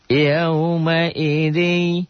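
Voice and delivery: slow, high chanted tilawah; Quran recitation. Shown as a demonstration. Teacher reciting the verse for the class, slow chant. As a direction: draw the words out melodically.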